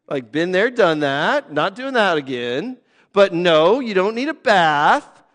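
ordinary talk, nothing marked

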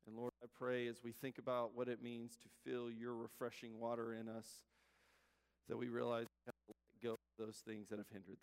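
low, soft, even praying voice; prayer. Real, not acted. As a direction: lead a quiet, earnest prayer. Leads the congregation in prayer.

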